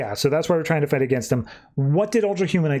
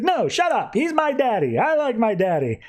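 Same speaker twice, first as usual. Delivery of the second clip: put-on high childish voice, far higher than his own; kid voice; 9 semitones higher than usual. Recapping the comic, voicing young John as a child. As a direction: pitch it up like a sulky little boy.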